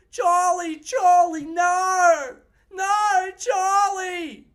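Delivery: desperate high yelling, calling out a name; frantic shouting. Retold or imitated. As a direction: scream his name in panic. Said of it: "this one other Australian lady would go".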